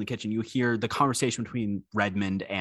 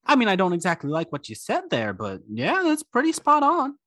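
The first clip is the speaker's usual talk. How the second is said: high character voice; 8 semitones higher than usual. Acted. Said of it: "I think Coyote goes".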